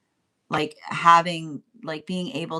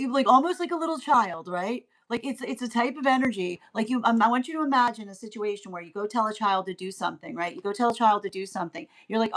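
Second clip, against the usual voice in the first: ordinary talk, nothing marked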